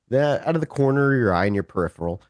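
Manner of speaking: ordinary talk, nothing marked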